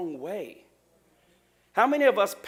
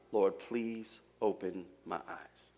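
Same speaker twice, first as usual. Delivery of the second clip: silent prayer; 10 semitones lower, 10 dB quieter, slower than usual. Shown as a demonstration. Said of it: "I want you to silently pray".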